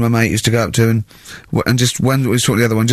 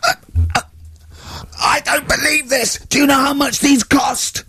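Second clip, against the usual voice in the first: a ranting teacher pitched way up and slowed, hammed right up; mock furious teacher; 14 semitones higher than usual. Retelling the French teacher losing it with the class, hammed-up high rant. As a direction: play the teacher blowing his top, very high. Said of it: "and he went mental".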